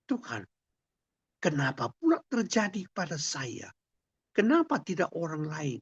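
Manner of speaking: high-pitched, protesting cries; a desperate, angry plea to heaven; angry cry to God. Acted. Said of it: "Lidwina berteriak-teriak, marah kepada Tuhan".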